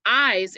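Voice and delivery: "eyes" stressed, with a long "I" sound; strong, full "eyes"; stressed syllable. Shown as a demonstration. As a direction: hit "eyes" firmly and hold the vowel. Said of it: "is definitely a stressed syllable".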